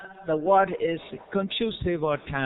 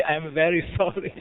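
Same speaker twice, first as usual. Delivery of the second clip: evenly pitched, amused mock apology; smiling apology. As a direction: apologize through a smile, close to a chuckle. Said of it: "With a smiling face".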